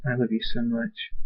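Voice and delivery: very soft, hushed affection; tender. A narrator's reading. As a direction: say it softly into a hug. Hugs her after the danger.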